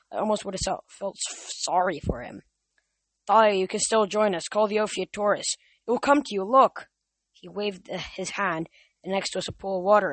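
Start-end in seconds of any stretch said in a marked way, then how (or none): 3.3-6.9 rising louder, higher coaxing; tempting urging. A narrator's reading. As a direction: lure her in with mounting eagerness. Atlas tempting Thalia.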